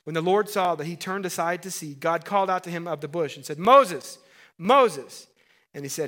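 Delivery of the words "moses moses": loud, high call of a name, twice. Acted. God calling out to Moses from the bush, loud and ringing.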